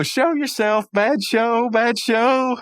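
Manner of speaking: high, mock-trembling old gent; Pops voice. Pops on his bed, trembling at the invisible wizard, high and shaky. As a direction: squeak it out like a scared proper old man. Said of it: "He's going".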